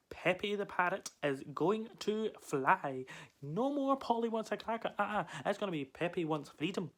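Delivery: rally yourself for the great escape; triumphant proclamation. Acted. Parrot vows to fly tonight, triumphant and high.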